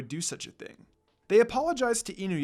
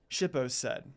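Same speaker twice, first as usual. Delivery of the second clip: ordinary delivery, nothing marked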